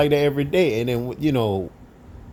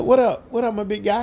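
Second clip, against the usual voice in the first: high greeting; 10 semitones higher than usual. Retold or imitated. Coworker hails him across the lunch room, high.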